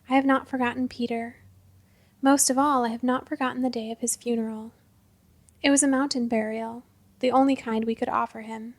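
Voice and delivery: ordinary delivery, nothing marked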